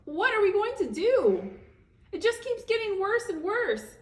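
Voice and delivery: high, loud desperate cry; distraught wail. Acted. Mother cries out in panic, high and loud. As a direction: wail it out in despair. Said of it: "Missus Cream began to sob".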